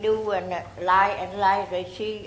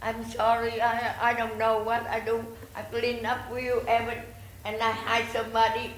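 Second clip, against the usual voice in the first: normal talking voice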